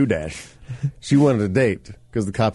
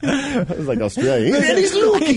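far higher, louder, laughing mock caller; high mock British caller; 3 dB louder than usual. Host hamming up the British caller staring at the moon, high and laughing. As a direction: squeal like the dim caller who spots a man in the moon.

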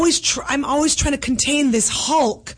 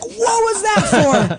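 mock shocked son; 7 semitones higher, slower than usual. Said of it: "just cold clock him and be like".

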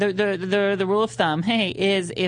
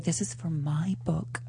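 slow, awed unvoiced whisper; 3 semitones lower, 7 dB quieter, slower than usual. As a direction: whisper it in disbelief. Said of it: "you're like".

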